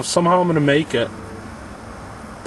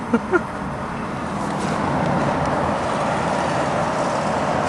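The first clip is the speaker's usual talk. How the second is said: real breathy laughing fit; helpless airy laughter; long wheezy laugh; 11 semitones higher, 6 dB quieter than usual. Real, not acted. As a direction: crack up, mostly breath, no words. Cracks up at scooter sighting.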